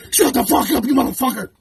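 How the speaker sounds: a loud, enraged insult; furious shout. Acted. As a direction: blow up and scream him down. Explodes at mocked rival.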